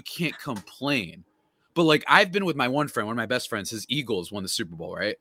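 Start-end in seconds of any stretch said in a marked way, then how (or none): none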